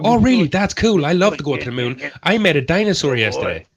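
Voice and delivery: very high, loud, gushing agreement; hammed-up delighted credulity; mock enthusiasm. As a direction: gush along with an obvious lie. Acts out a gullible Scientologist agreeing.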